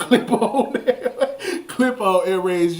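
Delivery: words broken up by giggles; real laughing. Real, not acted. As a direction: try to get the question out while laughing. Cracking up at own joke.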